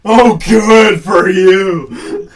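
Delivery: slow, loud sarcastic shout; hammed-up furious actor, drawn out. Actor ranting at film crew, mock sarcastic yell.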